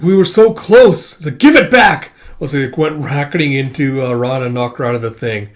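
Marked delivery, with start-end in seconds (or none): 0.0-2.1 higher and louder, building to a yell; desperate grab for what was taken; frustrated outcry. Acted. Demanding it back, a loud frustrated yell.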